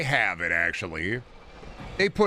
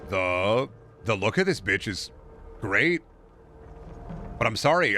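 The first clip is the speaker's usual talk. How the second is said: usual speaking voice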